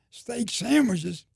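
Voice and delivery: drawn-out, high, loud disbelief; amazed echo. Retold or imitated. Amazed echo of the men's answer.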